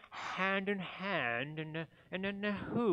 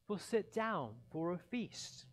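plain reading voice

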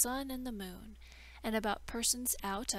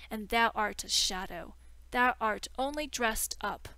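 angry defiance; 6 dB louder than usual. Acted. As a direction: shout him down in outrage. Learned man threatens to tell all.

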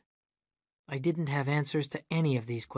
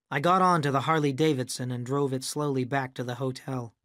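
usual speaking voice

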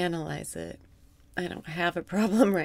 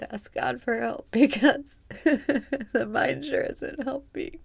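laughing; 5 semitones higher than usual. Real, not acted. Joking that the mind isn't helping, laughing. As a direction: giggle at the joke on the mind.

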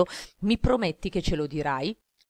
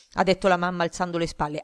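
usual speaking voice

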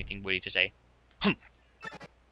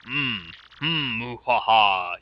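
villain laugh; much slower than usual. Acted.

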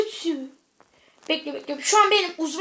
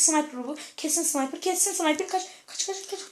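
urgent whisper; 2 semitones lower, 9 dB quieter than usual. Real, not acted. Urgent hushed warning.

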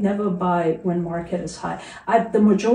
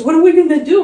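mock worried husband; 10 semitones higher, 11 dB louder, faster than usual.

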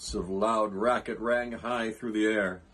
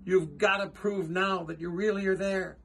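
urgent, higher-pitched plea to rally everyone; calling out; 6 semitones higher than usual. A narrator's reading. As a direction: urge the mayor on, voice raised in pitch. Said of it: "Horton called".

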